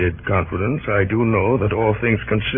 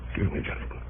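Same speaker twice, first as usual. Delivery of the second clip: whispered aside; 12 dB quieter, faster than usual. Acted. Aside while signing proclamation.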